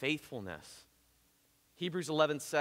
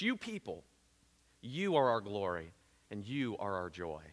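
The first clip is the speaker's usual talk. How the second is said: tender quote; 6 semitones lower, 3 dB quieter than usual. Acted. Quoting Paul tenderly to church.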